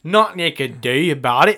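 lightly done Northern Irish commentator line; commentator impression. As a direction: mimic the commentator's catchphrase. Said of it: "Sound like [name] there".

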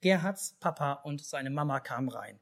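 plain reading voice

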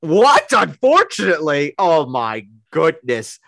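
high, loud, scandalised disbelief; mock outrage. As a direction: play up being deeply offended by the remark. Mock outrage at co-host's "Unfortunately.

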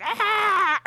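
high, loud creature shriek; imitated monster screech. Recounting the bug-like monster's landing, imitated screech. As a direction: screech like a collapsing giant bug. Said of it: "he just goes, like".

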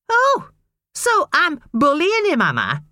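high, slow mock-outraged mother; sarcastic retort. A narrator's reading. Wife retorting to her husband, high and sarcastic. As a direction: throw his words back sarcastically.

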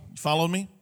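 ordinary delivery

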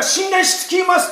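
high, slow and strong I trust; forceful vow. Retold or imitated. Preacher voicing a believer's forceful vow of trust, high and slow. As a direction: try to sound strong and sure. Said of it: "力強く言えなかったとしても".

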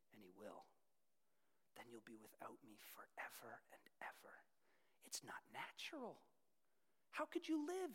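seduce him softly into keeping you; lizard whispering. Retold or imitated. Lust lizard pleading in the man's ear, coaxing whisper. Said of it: "And then the lizard speaks".

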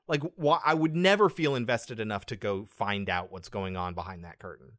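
ordinary delivery

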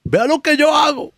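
mock shout of an order; retold call out. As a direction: call it out like the DJ boss telling him what to do. Roy instructing him, retold.

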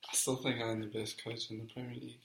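soft, level musing; pondering to himself. Real, not acted. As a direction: repeat it quietly while you think. Guesser repeats quote while thinking.

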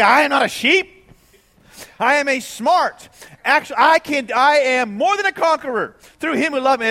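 mock the offended listener; indignant objector. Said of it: "they say".

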